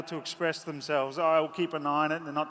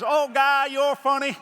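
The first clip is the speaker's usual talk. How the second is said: very high, loud American twang; imitated American gentleman; 8 semitones higher, 7 dB louder, slower than usual. The American man laughing at the rider's joke. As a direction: bellow it high and amused like the stranger.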